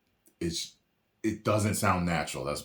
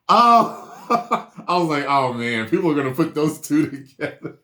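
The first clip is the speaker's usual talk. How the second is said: high, loud laughing "oh man"; laughing reaction; 8 semitones higher, 8 dB louder than usual. Retold or imitated. Reactor recalls foreseeing people's jokes, laughing loudly. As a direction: crack up as you relive the reaction.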